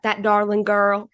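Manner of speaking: lightly put-on Texas accent. Shown as a demonstration. Podcast host showing her angry slip into a Texas accent. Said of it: "came out with my Texas accent".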